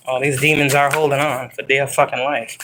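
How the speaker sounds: ordinary talk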